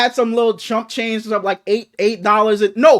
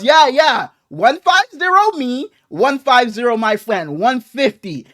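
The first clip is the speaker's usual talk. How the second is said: imitating broken English; 3 semitones higher, 4 dB louder, slower than usual. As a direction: spell the price out like a foreign cashier. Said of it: "her English was kinda, you know, a little so-and-so".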